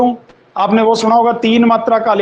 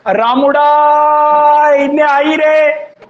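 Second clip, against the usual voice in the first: calling across a field; 6 semitones higher, much slower than usual. Shown as a demonstration. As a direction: call रामुड़ा from the far side of the field, stretching every vowel. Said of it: "आवाज मारी हो कभी".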